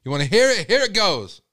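loud, rising hype; hyped-up announcing. Real, not acted. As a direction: pump up the crowd for the replay. Hyping a replay of the rap.

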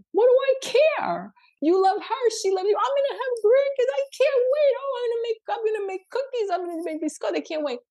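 very high, giddy mom gushing; overjoyed mother. Acted. Mother welcoming the bride and planning for grandkids, overjoyed. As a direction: squeal with delight as the eager future grandmother. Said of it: "And Mother said".

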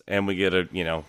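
ordinary delivery

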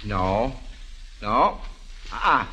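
ordinary delivery

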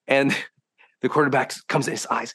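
ordinary talk

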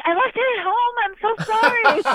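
high sweet mock-innocent voice; fake innocent apology. Actress dodging studio paperwork, high fake-innocent apology. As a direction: play the little innocent for the studio. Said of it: "And I'd be like".

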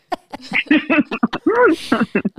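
loud burst of real laughter. Real, not acted. Laughing at soundboard button.

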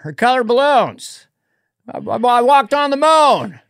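high, loud, rambling Biden voice; Biden impression. Comic doing Biden for the podcast host, high and loud. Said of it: "I did Biden last night".

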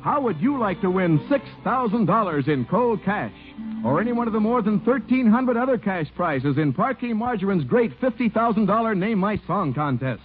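usual speaking voice

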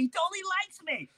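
very high, quick delighted voice; clueless little kid. Retold or imitated. Tommy delighted the dog likes him, high and quick. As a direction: gush it like a happy toddler. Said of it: "He's like".

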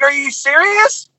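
stunned, drawn-out disbelief; mortified disbelief. Retold or imitated. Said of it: "I was mortified".

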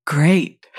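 normal talking voice

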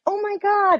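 very high, thrilled exclamation; imitated excited daughter. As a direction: mimic a kid's high-pitched amazement. Daughter thrilled by stacked glasses.

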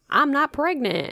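high, drawn-out retort; imitated sister-in-law. Sister-in-law to store worker, high affronted denial. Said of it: "And she's like".

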